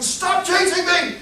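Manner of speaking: high, drawn-out yelled plea; yelling. Acted. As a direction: yell it high, desperate to shake off a pursuer. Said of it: "Abner's yelling".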